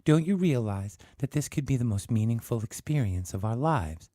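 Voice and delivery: coldly. Acted. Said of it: "Susan said coldly".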